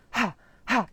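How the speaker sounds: HA, HA as short puffs from the belly; forceful, separate aspirated "Ha"s; belly aspirate. Shown as a demonstration. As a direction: push each "Ha" out with strong breath. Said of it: "you feel it in your belly".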